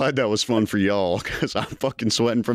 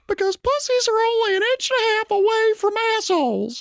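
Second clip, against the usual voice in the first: tiny squeaky cookie voice; gingerbread man voice. Acted. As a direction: pitch way up, squeaky cartoon cookie. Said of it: "A gingerbread man".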